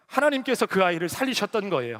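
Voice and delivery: usual speaking voice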